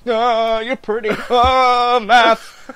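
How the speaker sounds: high, hammed-up quivering wail; quivering guy. Quivering guy left alone facing Stacey, mock terror. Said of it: "this fucking quivering guy who's like".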